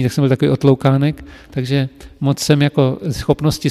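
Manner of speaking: ordinary delivery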